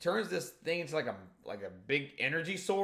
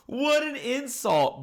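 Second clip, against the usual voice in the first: amazed exclamation; 10 semitones higher, 6 dB louder, slower than usual. Real, not acted. Reacting to Big Mom's roast.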